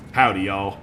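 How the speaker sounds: low, lightly put-on Texan greeting; Texas accent. Shown as a demonstration. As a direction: have a go at a Texas drawl. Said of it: "should have given him a Texas accent".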